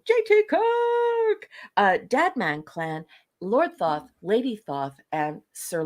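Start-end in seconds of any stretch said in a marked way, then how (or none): 0.1-1.5 high, slow, drawn-out announcer call; dramatic announcer. Acted. Announcing a fan's name on the show, high and slow. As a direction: stretch the name out like a grand introduction.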